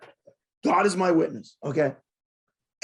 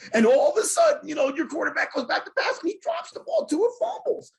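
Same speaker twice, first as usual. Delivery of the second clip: pitched up and louder, a laugh in it; rising glee over the fumbles; high, laughing excitement at the blunder; excited; 7 semitones higher, 4 dB louder than usual. Real, not acted.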